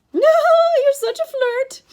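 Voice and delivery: loud, high, coy falsetto. A narrator's reading. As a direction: flirt in a false lady's high voice. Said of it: "Grover said in his falsetto voice".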